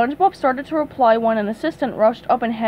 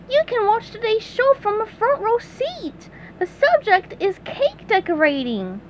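SpongeBob voice; 10 semitones higher than usual. Acted. SpongeBob as host.

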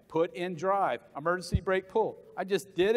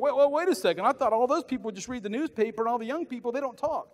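surprised people; 6 semitones higher, 4 dB louder than usual. Retold or imitated. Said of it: "people are like".

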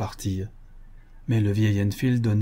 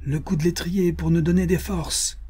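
higher-pitched rallying cry; hearty exclamation; 7 semitones higher than usual. A narrator's reading. Bill Cody offering a parting drink, hearty exclamation. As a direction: call out the invitation with spirit. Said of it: "s'écria Bill Cody".

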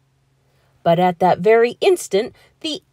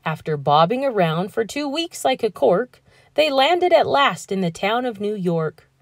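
usual speaking voice

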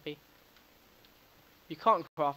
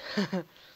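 short laugh; 4 semitones higher, 5 dB louder than usual. Real, not acted. Laughs after sneezing.

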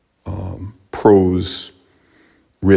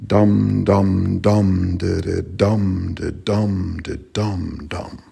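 dum-da beat of the meter, fading away; chanted meter rhythm. Shown as a demonstration. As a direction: chant long dums and short das. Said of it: "it sort of goes like".

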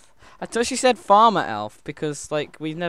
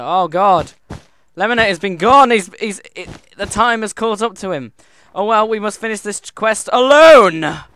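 loud hammed-up mourning; mock tragic lament; 5 semitones higher, 10 dB louder than usual. Mourning a disconnected teammate in hammed-up tragedy. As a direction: grieve the lost friend like a stage hero.

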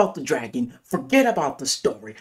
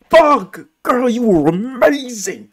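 excited outburst; 5 semitones higher, 4 dB louder than usual. Real, not acted. Gushing over Millie Bobby Brown.

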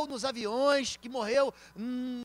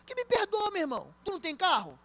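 mock indignation; 7 semitones higher than usual. Pastor mocks the apology.

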